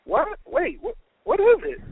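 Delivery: high-pitched, baffled string of questions; ask, startled and totally puzzled; bewildered questioning. A narrator's reading. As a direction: pitch shooting up in confusion. Puzzled worker questions excited coworker.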